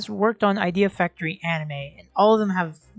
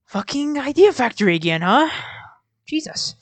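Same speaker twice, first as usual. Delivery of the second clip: raised pitch, exasperated at the repeat; incredulous, higher-pitched grumble; exasperated disbelief; 5 semitones higher, 3 dB louder than usual. Real, not acted. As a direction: react as if fed up with the pattern. Same studio turns up yet again.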